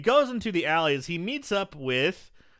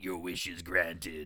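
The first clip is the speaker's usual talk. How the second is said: much lower, slow, softer villain voice; sinister djinn; 10 semitones lower, 8 dB quieter, slower than usual. Retold or imitated. Wishmaster granting the drunk's wish, low and sinister. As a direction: intone it low and slow like a menacing genie. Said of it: "And he's like".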